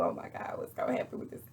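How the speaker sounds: low, voiceless aghast whisper; hushed shock. Retold or imitated. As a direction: whisper it under your breath, aghast. Her stunned reaction to the fight.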